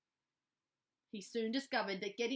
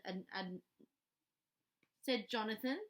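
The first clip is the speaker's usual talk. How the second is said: ordinary delivery, nothing marked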